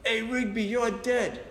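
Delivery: high taunting yell up at a window; retold yelling threat. Rival threatening him below the bedroom window, yelled. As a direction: call up a threat from the street. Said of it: "he's yelling up to my window".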